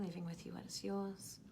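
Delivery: guided meditation. Acted. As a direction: guide them gently back into the body.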